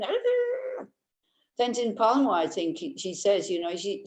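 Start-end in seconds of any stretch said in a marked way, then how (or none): 0.0-0.9 put-on high, squealing toddler tantrum cry; a tiny child roaring in a temper; child's angry growl. Teacher mimics a toddler's tantrum, high mock roar. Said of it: "like a small child, you know, going".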